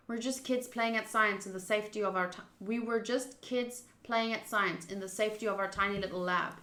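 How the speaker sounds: ordinary talk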